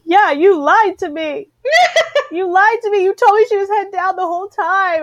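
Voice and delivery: high, laughing mock outrage; laughing accusation. Patient laughing at doctor who said baby was head down, mock accusing. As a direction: laugh while accusing him.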